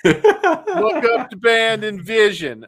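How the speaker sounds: slow, loud mock Southern drawl; hammed-up Southern accent; Southern boy accent. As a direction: sell it like a country huckster. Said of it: "my old Southern boy accent".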